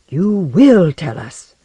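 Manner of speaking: loud, slow, menacing order; insistent, each word drawn out loudly; forceful demand. A narrator's reading. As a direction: bear down hard on a reluctant witness. Man leans over patient, demanding answer.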